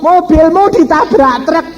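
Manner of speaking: very high imitated shout; wife's yell. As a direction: mimic a wife hollering from outside. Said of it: "Istri saya berteriak".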